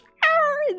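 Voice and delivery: cat cry imitation. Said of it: "the cat goes".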